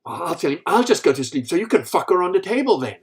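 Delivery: loud, high furious outburst; storming-off wife. Acted. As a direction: blurt it as the fed-up wife. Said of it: "she storms off".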